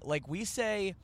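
usual speaking voice

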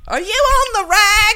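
mock Australian. Picturing Australians saying it, high slow mock Aussie. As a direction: ask it like a cartoon Australian bloke. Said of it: "Australians sitting around being like".

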